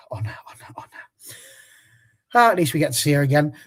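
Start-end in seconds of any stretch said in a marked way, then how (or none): 0.1-0.9 wistful whisper. Real, not acted. Sighing over departing player.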